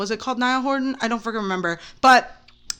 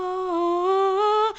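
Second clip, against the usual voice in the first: high sustained angelic "ah"; angel music; 7 semitones higher, 3 dB quieter than usual. Retold or imitated. Recalling first sight of a celebrity, angel music. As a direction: imitate a cartoon heavenly choir note. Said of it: "like the angel music in the cartoons".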